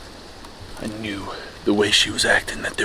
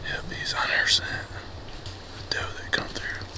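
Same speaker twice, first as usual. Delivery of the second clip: whispering; 8 semitones higher, 10 dB quieter than usual. Real, not acted. Whispering as second buck approaches.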